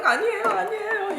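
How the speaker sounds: high, hurried mock pleading; frightened pupil. Pupil denying it to angry teacher, high timid imitated plea. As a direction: plead like a scared child.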